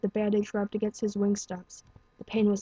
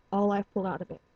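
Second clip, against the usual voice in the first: ordinary delivery, nothing marked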